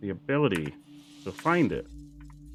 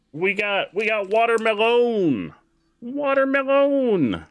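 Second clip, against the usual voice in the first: high, slow, drawn-out voice; high silly voice; 12 semitones higher, 5 dB louder, slower than usual. Acted. Announcing candy flavor.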